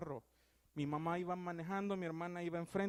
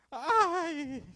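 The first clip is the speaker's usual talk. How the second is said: high, loud yelp of fright, laughing after; startled scream; 10 semitones higher, 9 dB louder than usual. Shown as a demonstration. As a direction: shriek like someone suddenly scared. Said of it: "Que le sale ese grito".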